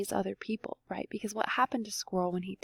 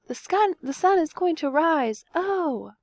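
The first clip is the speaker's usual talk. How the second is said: much higher, eager little squirrel voice; piping squirrel; 8 semitones higher, 4 dB louder than usual. Acted. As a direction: pipe it up high like a tiny squirrel. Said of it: "Little Brown Squirrel piped up".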